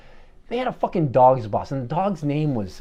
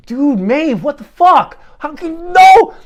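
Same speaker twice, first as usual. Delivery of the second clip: much higher and louder, a frantic yell; shouting in disbelief; 13 semitones higher, 12 dB louder, slower than usual. Retold or imitated. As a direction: scream at her to stop, rising to a shriek on no. Bandmates yelling at Maeve.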